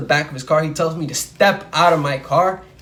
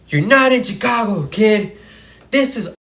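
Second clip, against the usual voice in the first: retold scream; 4 semitones higher than usual. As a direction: yell it in his face like a power-tripping cop. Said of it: "he screamed at my face".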